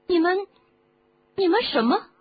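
put-on curious boy voice. Young Harry asks curiously.

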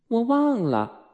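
put-on high-pitched child's offhand reply; casual, unconcerned. A narrator's reading. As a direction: toss it off lightly as young Wendy. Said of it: "温蒂不在意地说". (小女孩不在意地说)

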